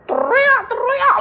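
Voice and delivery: very high, slow possessed shriek; mock demon shriek. Orang kerasukan berteriak. (teriakan setan yang sangat tinggi dan lambat)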